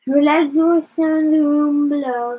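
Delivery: ordinary delivery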